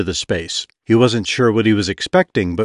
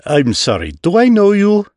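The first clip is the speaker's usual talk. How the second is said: affronted; 9 semitones higher, 3 dB louder than usual. A narrator's reading. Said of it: "failed to hide his affront".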